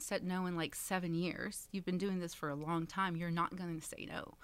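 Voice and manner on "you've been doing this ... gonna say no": usual speaking voice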